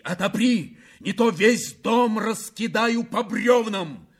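high, furious demand; shouted threat. A narrator's reading. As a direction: shout the threat, stretching the words. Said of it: "кричал Вяземский".

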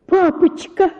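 высокий испуганный крик «Папочка!»; закричать в ужасе. A narrator's reading. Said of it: "закричала я в полном ужасе". (дочь в ужасе зовёт отца, высоким криком)

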